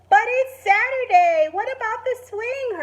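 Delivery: put-on high, loud whiny little boy voice; little brother protesting. Little brother protests Tilly going on Saturday, high and whiny. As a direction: plead with her, high and upset. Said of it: "her brother called".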